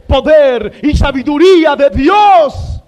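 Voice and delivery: slow, loud declaration; triumphant proclamation. Real, not acted. Preacher exalting Christ before the congregation, triumphant proclamation. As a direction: cry it out, stretching each word.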